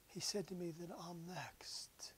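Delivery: slow, hushed whisper of a dying woman; slow awed whisper. Retold or imitated. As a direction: let the words drift out slowly, barely voiced. Dying woman speaks softly.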